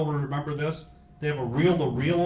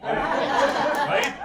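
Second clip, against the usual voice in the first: laughing plea; 12 semitones higher, 6 dB louder than usual. Real, not acted. Joking about statute expiry.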